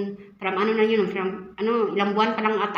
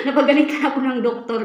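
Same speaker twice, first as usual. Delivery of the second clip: laughing it out, a bit higher and louder; 4 semitones higher, 4 dB louder than usual. Real, not acted. Vlogger laughing as she admits the doctor scolded her. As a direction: giggle through the words.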